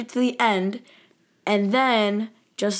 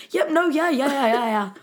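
higher, rushed string of yeahs; hasty awkward yeses; 5 semitones higher than usual. Retold or imitated. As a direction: brush him off politely in a hurry. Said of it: "Doctor Kroger and his wife are like".

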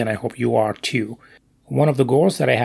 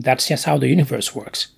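ordinary talk

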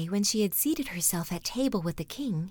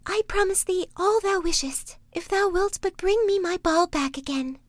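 high, louder princess; 10 semitones higher, 4 dB louder than usual. Acted. Princess answering the frog: high voice. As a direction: lift the voice up high for the young princess. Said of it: "said she".

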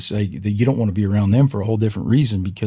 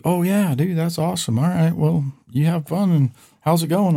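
higher-pitched, retold friendly enthusiasm; upbeat buddy on phone; 8 semitones higher than usual. Buddy hears about the lake trip, retold upbeat. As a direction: play the buddy cheering him on.

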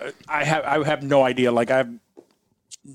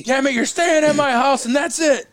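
higher, louder mock outburst; mock angry; 12 semitones higher, 3 dB louder than usual. Dad overruling daughter, hammed-up anger. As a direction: pretend to put your foot down.

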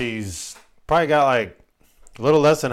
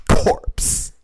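whispered corpse; 3 dB louder than usual. Shown as a demonstration. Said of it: "It's so bad".

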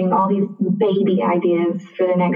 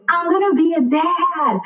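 high, stretched-out elated cry; retold joyful announcement; 9 semitones higher than usual. Boyfriend turns to his room, high excited announcement. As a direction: imitate him proudly announcing it to everyone.

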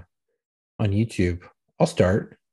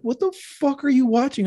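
much higher, mock-appalled question; retold incredulous outburst; 11 semitones higher than usual. Parent catching daughter watching ASMR, incredulous. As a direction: act out disbelief in a raised pitch.

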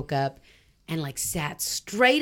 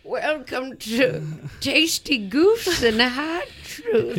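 put-on old man voice; 5 semitones higher, slower than usual. Old voice show intro.

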